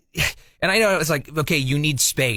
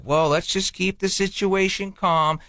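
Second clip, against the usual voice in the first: slow, measured peacekeeping voice; smooth it over slowly and evenly; mock calming; 3 semitones higher, slower than usual. Husband calming his wife after her fight, soothing.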